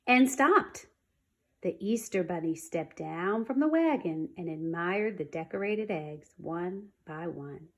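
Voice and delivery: ordinary delivery, nothing marked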